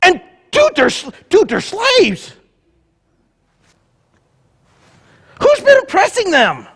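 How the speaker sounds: high, surprised, loud mock panic; a flustered deity caught off guard, hammed up; panicked God. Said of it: "God was in heaven going".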